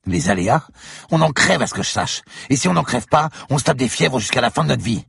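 high, loud indignant protest; angry outburst. Acted. Del Piero protesting to the commissioner about the danger. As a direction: throw his words back at him.

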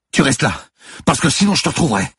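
high, loud warning; urgent, yelled command; shouted threat. Acted. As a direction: bark the order before running off. Cop orders the boy to stay.

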